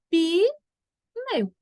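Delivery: "pneu" split as pi, pause, neu; extra i sound. Shown as a demonstration. Teacher showing how pneu sounds when spoken, split in two. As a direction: hold an added i in "pneu", then land neu. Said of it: "ó o sonzinho sempre do i".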